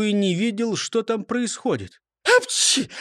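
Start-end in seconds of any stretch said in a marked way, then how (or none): 2.4-2.9 high-pitched "apchi" sneeze; acted sneeze. A narrator's reading. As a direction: let out a squeaky stage sneeze. Character's recurring sneeze.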